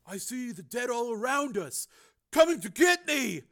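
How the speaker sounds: high, frightened raving; haunted fear. A narrator's reading. Crazed brother seeing the dead, haunted fear. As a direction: build from hushed dread to alarm.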